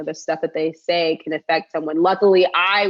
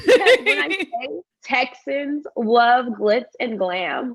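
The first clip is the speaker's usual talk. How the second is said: laughing; 6 semitones higher, slower than usual. Real, not acted. Laughing while recalling the phrase.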